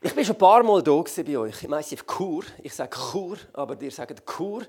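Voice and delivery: ordinary delivery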